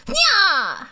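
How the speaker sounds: high, loud chopped nya; high, loud karate nya; karate kid nya. Pretend. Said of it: "I do sound like a karate kid".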